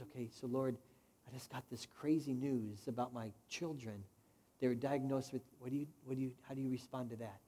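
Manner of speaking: soft, low, inward plea to God; a hushed, confiding prayer. Retold or imitated. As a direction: talk to God quietly, searching for what to do. Retold prayer in a crisis.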